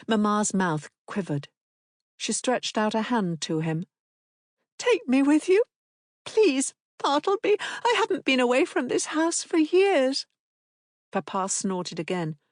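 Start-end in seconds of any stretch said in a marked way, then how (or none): 4.8-10.3 a wife begging to come along. A narrator's reading. Wife begging husband to take her, high.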